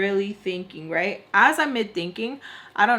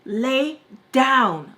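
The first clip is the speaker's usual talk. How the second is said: louder, higher mock command; raised, commanding retold voice; imitated order; 4 semitones higher, 6 dB louder than usual. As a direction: imitate a sudden loud order mid-drink. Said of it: "like that".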